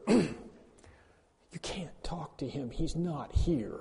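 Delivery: slow, hushed whisper. Retold or imitated. Pastor imagining telling a widow, hushed whisper. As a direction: breathe it out barely voiced. Imagined cold remark at grave.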